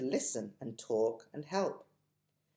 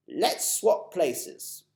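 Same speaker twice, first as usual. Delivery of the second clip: put-on slow, old-fashioned fussing of an elderly tramp; lightly voiced, dotty old gentleman; fussy old gentleman; 5 semitones higher, 6 dB louder, much slower than usual. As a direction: potter about like a polite old gent. Mister Stink, gallant.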